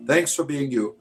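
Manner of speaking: ordinary talk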